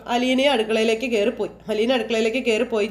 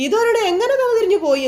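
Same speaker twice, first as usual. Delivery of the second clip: angry retort; 10 semitones higher, 4 dB louder than usual. Retold or imitated. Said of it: "എന്ന് വൈജ ചോദിച്ചു".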